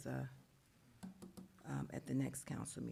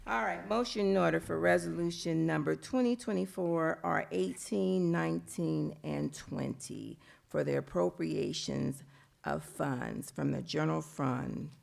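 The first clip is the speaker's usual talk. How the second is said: booming the motion for the room; louder, energized; 7 dB louder than usual. Real, not acted. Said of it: "so let me get the energy back".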